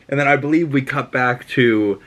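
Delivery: ordinary delivery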